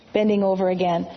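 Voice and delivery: plain reading voice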